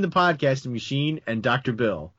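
normal talking voice

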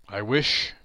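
usual speaking voice

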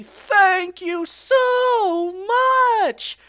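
high, loud, drawn-out shout of thanks; exuberant thanks. Real, not acted. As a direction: stretch every word in a joyful yell. Gushing thanks to listeners.

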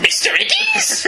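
very high, slow squawked shout; hammed-up Cockney shout. Mocking Constantine with a high slow Cockney shout. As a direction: screech the name like a music-hall Cockney. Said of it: "expected it to follow it up with".

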